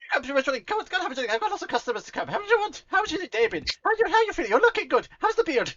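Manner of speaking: high, loud, gushing tavern host; excited beholder tavernkeeper. Acted. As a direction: greet them like an overjoyed innkeeper. Luigi offers drinks.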